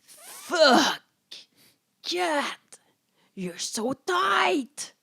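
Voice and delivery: hammed-up lover. Voicing Ezra's line to the podcast audience, mock passion. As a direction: ham up the aroused lover.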